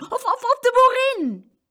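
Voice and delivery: high, loud, flustered stammer; stammered shout. A narrator's reading. Boy shouting for any grown-up, flustered shout. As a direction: shout it high, tripping over the first word. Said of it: "het geschreeuw".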